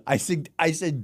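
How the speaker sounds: normal talking voice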